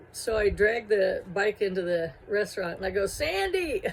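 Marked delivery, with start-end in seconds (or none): 3.1-3.8 high call across the room. Retold or imitated. Dragging her bike inside, she calls out to her boss. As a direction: call out her boss's name, pitched up high.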